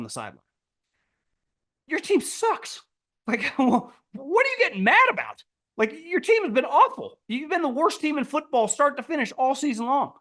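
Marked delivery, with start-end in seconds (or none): 1.9-2.8 high, mocking disbelief; pitched-up sneer at the owner; incredulous mock taunt. Mocking angry owner at fans. 3.3-10.1 high, laughing incredulous rant; mock disbelief. Mocking angry owner at fans.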